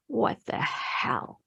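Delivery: whispered, slow and drawn out; slow disbelieving whisper. Retold or imitated. Recalling the emergency, she whispers her disbelief slowly. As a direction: let disbelief drain the voice. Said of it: "I'm in this space of".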